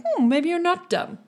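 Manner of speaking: a snooty elf admitting you might be smart; amused elf. Acted. High elf grudgingly reassesses the player, high and amused.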